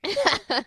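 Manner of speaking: short high laugh at herself; real embarrassed laugh. Real, not acted. Coach laughing at herself after spilling coffee.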